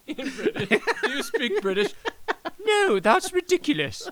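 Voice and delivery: high, laughing mock British voice. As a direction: ham up a British accent through laughter.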